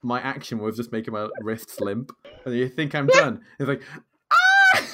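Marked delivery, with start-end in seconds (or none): 4.3-4.8 piercing, very high sung scream; loud shriek. Retold or imitated. Boy in the bath when you think he's done, shrieking. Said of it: "Louder and louder".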